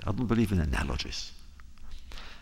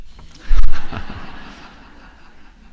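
big burst of unvoiced laughter; audience-style hearty laugh; loud laugh; 17 dB louder than usual. Real, not acted. Laughs at own joke.

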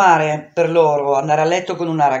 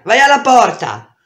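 loud, higher barked command; shouted order; 7 semitones higher, 9 dB louder than usual. Acted. A cornered girl orders the boy to the door, shouting. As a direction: bark the order at him across the room.

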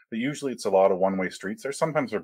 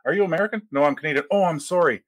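mock exchange; 7 semitones higher than usual. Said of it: "this is the best when you're traveling".

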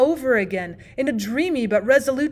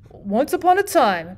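slow, louder story opening; storytelling opening; 3 semitones higher, 5 dB louder than usual. A narrator's reading. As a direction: start the tale with a flourish. Bachelor begins story.

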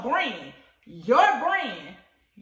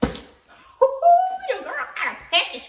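much higher and louder, slow and gleeful; excited; 14 semitones higher, 9 dB louder, much slower than usual. Real, not acted. Vlogger showing viewers her new package, squealing with joy. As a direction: crow about the package with a laugh.

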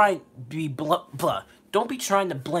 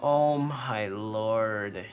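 slow groan; 6 semitones lower, 4 dB quieter, much slower than usual. Real, not acted.

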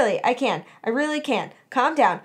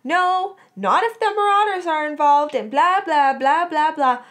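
high, loud venting rant; fuming; 5 semitones higher, 5 dB louder than usual. A narrator's reading. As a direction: rant about the nagging friend, pitched up and pushing. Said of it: "Melody fumed to Sirius".

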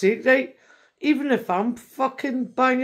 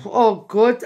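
normal talking voice

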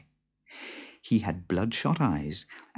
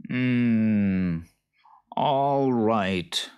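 grudging boggart; 3 semitones higher, 4 dB louder, much slower than usual. A narrator's reading. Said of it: "said the boggart".

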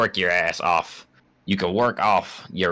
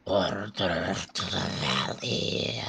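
slow, soft, rasping creature voice; creature whisper; 7 dB quieter, slower than usual. Pretend. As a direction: hiss it like an eerie beast. Voicing game creatures.

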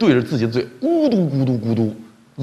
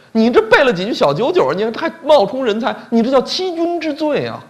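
indignant accusation; 9 semitones higher than usual. Acted. King accuses the petitioner.

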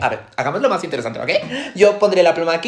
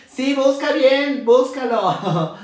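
slow, drawn-out insistence; insistent urging; 6 semitones higher, much slower than usual. Acted. Girl to friend searching her bag, insistent urging. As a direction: stretch the words out, urging her on.

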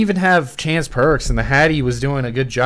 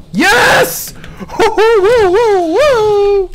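excited shout; 8 dB louder, much slower than usual. Real, not acted. Celebrates winning moment in game.

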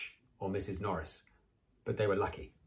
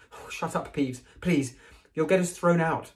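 pleading; 8 semitones higher, 4 dB louder than usual. Acted. Pleading with Peeves.

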